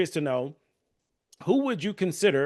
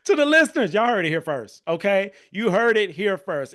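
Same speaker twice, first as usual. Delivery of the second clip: higher, louder proclamation to the audience; emphatic announcement; 6 semitones higher, 5 dB louder than usual. Real, not acted. Host tells listeners they heard it here first, emphatic announcement. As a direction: announce it proudly to everyone listening.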